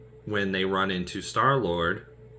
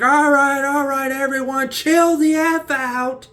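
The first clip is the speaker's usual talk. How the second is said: high, loud, slow exasperated call; Peter Parker calming them; 10 dB louder, slower than usual. Retold or imitated. Peter Parker yelling at everyone to calm down, high and loud. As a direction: shout them down to chill. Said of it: "He's like".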